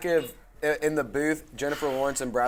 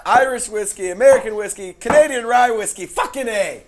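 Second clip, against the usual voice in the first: higher, louder excited listing; hyped up; 7 semitones higher, 6 dB louder than usual. Real, not acted. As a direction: pump up each whiskey.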